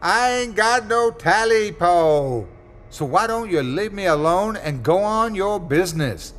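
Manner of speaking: Smitty yelling back at the voice in the house; yelling as loud as he could. Acted. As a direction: yell back at the voice with all you have. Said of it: "yelled back as loud as he could".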